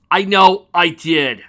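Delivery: loud, slow, stressed know; exasperated shout. Real, not acted. Player shouting about the roll, exasperated. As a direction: yell it in disbelief.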